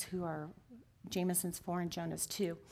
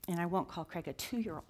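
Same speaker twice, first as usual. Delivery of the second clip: ordinary talk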